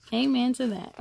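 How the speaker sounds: plain reading voice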